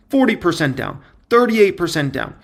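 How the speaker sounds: ordinary talk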